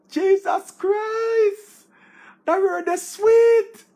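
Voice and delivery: high, loud, slow cry of delight; ecstatic shout. Real, not acted. Thrilled by a big word, shouting with delight. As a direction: howl with joy at the word.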